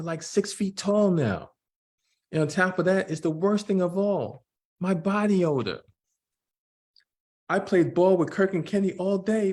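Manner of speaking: put-on young Jordan voice. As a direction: play the twelve-year-old son, worried. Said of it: "I'm gonna try to do voices to distinguish".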